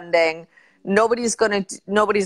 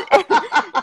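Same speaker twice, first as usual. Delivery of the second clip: high laugh; 9 semitones higher than usual. Real, not acted. Laughing at own joke.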